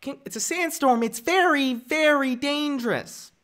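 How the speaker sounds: high, put-on child's voice; high kiddie voice, drawn out at the end; young Anakin. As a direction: warn earnestly like a little boy. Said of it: "the one from Anakin in Phantom Menace".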